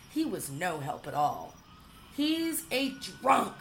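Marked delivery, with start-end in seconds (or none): 2.1-3.5 high, slow scornful outburst; scornful cry. A narrator's reading. Sister in the barn, a high slow scornful cry. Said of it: "said Arabella".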